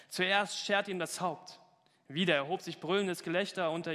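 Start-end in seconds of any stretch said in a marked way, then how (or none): 0.1-1.6 slow, gloating command; witch's order. Acted. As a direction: savour the cruel order slowly. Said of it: "rief die Hexe".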